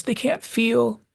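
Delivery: high, slow, aching plea; slow high-pitched begging to stay; Charlie's last plea. Retold or imitated. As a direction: plead slowly as the last chance slips away. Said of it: "and he says".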